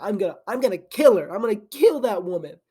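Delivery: mock angry, lean on kill; fuming threat. Ace fuming at Nami for stealing the ship, mock angry. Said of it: "He gets fuming".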